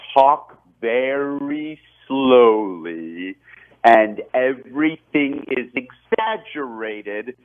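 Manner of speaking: drawn-out, stretched syllables, every word overplayed; slow it right down and exaggerate every word for the speed-up; very slow, exaggerated. Shown as a demonstration. Actor showing how chipmunk lines get recorded, very slow and exaggerated. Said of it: "the way he described it is".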